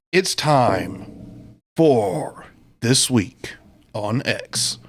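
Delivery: announcer read. Acted. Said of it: "show segment announcement".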